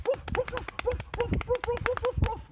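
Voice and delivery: very high, loud yappy woofs; dog barking. Acted. Dogs running out into the park, excited barking. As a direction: bark in excited high bursts.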